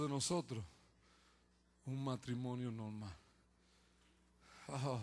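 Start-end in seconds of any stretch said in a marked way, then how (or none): none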